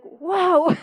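high, slow, amazed coworker with a laugh; mock-amazed office gossip; impressed coworkers. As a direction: gush like a stunned coworker. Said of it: "they were like".

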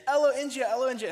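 put-on high, loud toddler demand; imitating toddler daughter. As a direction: mimic a small child insisting on her show. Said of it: "she kept saying".

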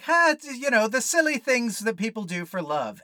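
high, loud flippant mockery; mock the movie's carefree shrug; mocking breezy voice. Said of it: "the movie is just like".

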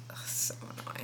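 whispered, fed-up ugh; annoyed ugh. Real, not acted. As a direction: breathe it out, really annoyed. Complaining about decorative clutter.

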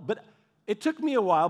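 ordinary delivery

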